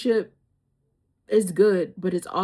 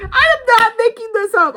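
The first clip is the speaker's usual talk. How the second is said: much higher and louder, almost shouting; excited outburst; 13 semitones higher, 11 dB louder than usual. Real, not acted. To viewers, insisting the story is real, loud excited outburst. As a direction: blurt it out, insisting it's true.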